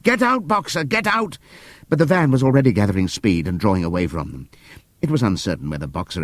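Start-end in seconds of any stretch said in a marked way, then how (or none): 0.0-1.4 high, urgent call; crying out together. Acted. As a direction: urge him out. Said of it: "took up the cry".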